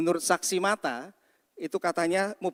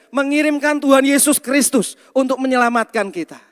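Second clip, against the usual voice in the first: loud, raised proclamation; forceful preaching; 7 semitones higher, 10 dB louder than usual. Real, not acted. Pastor telling congregation how God saves sinners, forceful preaching. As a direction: drive the gospel point home at full voice.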